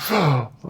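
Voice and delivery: a sharp demo gasp in; newborn's first gasp. Shown as a demonstration. Speaker shows the room a newborn's first breath, sharp gasp. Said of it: "first breath".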